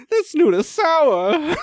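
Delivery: high, slow, loud pompous voice; disdainful old-timey declaration; hammed-up toff; mock aristocrat. Period-drama parody continues.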